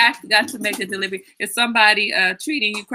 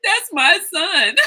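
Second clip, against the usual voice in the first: proud tears; 9 semitones higher, 4 dB louder, much slower than usual. Retold or imitated. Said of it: "I was like".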